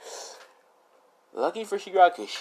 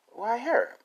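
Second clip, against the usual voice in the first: much higher, puzzled why her; surprised question; 13 semitones higher than usual. Retold or imitated. Girl questioning a friend choice, high and surprised. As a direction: ask it in disbelief.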